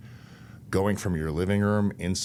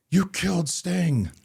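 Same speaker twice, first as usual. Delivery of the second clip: high, slow, loud tearful wail as a fan; imitating distraught fan; 13 semitones higher, 6 dB louder, slower than usual. Fan in meltdown confronts Vampiro at signing, high tearful wail. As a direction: mimic a fan on the verge of tears, pleading slowly. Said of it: "this emotional meltdown".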